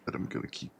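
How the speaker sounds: whispered repeat of the line. Shown as a demonstration. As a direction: breathe it out as a whisper.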